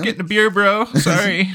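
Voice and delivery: high laid-back dude voice; chill bro voice. Retold or imitated. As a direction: shrug it off like a carefree kid. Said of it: "he's just like".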